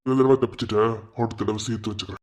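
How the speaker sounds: muttered threat. Acted. Senior threatens on leaving.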